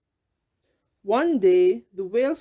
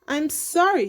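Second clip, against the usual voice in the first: higher, apologetic; ashamed; 7 semitones higher than usual. A narrator's reading. Rainbow Fish apologizing to the whale, ashamed. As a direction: say sorry sincerely. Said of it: "Rainbow Fish was ashamed".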